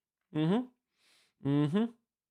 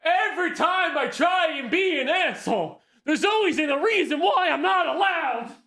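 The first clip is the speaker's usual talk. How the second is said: high laughing yell; laughing high shout; 13 semitones higher, 3 dB louder than usual. Real, not acted. Laughing complaint at chat.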